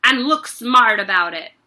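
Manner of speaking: loud scolding order; bullying command; bossy. Acted. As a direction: hurry him up harshly. Headmistress bullying boy.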